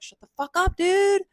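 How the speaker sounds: hiss-shout at a friend to calm down; squeaky scolding Prometheus. Retold or imitated. Prometheus scolding loud Zeus.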